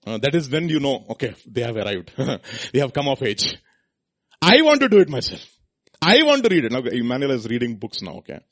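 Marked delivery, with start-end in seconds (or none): 4.4-6.7 put-on higher, louder insistent child; eager child. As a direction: insist like a keen youngster. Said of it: "children say".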